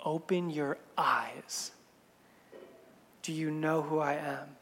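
low, soft, slow and weighty; hushed retold revelation. Jesus reveals who he is to his hearers, hushed and low. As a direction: drop right down for a quiet, grave challenge.

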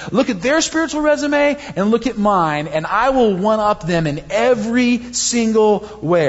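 high, cocky bragging; smug rival showing off his resume; boastful one-upping. Retold or imitated. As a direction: brag like you're winning a contest. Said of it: "he's gonna say".

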